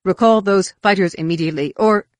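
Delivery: loud, urgent order; exclaimed demand. A narrator's reading. Roel demanding the fighters' recall, exclaimed. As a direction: demand it, building to an unfinished threat. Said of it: "Roel exclaimed".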